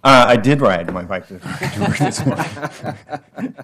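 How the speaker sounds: laughing through the words; chuckling reply, light and amused. Real, not acted. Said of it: "You're very generous".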